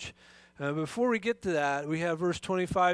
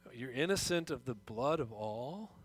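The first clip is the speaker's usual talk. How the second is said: softer, lower, rising disbelief; quizzical echo; 4 semitones lower, 7 dB quieter than usual. Retold or imitated. Pastor echoing Paul's words as a puzzled listener, soft and rising. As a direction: echo the claim back as a doubtful question. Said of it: "why would you say it like that".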